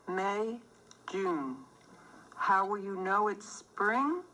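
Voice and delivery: usual speaking voice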